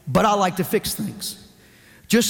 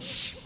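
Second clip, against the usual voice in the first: a quiet unvoiced shush; soft hush; 12 dB quieter than usual. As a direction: hush her gently with a breath. Hushing his wife.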